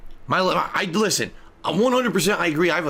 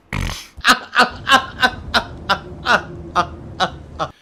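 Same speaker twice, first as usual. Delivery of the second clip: real laugh; 4 semitones higher, 3 dB louder than usual. Real, not acted. Showing chat his real laugh, a wheezy cackle. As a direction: own odd wheezing laugh. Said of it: "here's how I laugh".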